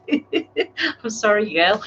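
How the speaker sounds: apologising through a real laugh; slow, laughing apology. Real, not acted. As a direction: giggle while saying sorry. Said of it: "I don't mean to laugh".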